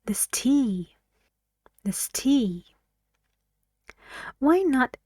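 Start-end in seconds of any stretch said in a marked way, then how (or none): 0.0-2.7 "tea" stressed, "This" light; "This tea" with the beat on "tea"; stress on the noun. Shown as a demonstration. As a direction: lean on "tea" after an unstressed "This". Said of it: "the noun is stressed".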